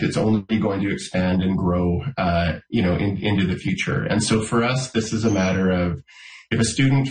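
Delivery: ordinary delivery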